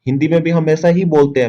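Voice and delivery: normal talking voice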